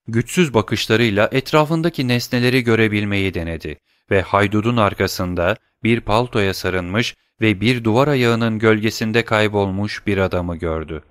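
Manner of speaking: usual speaking voice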